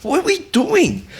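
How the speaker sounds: high, loud incredulous cry; retold exasperated demand. Soldier grabs team leader under fire, high exasperated demand. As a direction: imitate an exasperated challenge, voice shooting up.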